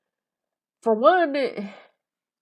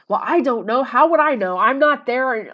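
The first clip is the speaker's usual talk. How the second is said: imitated irritated snap, louder and higher; mock angry retort; 4 semitones higher, 7 dB louder than usual. Narcissist snapping at her over the phone, mock angry. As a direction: throw back the question in mock annoyance. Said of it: "he got really mad".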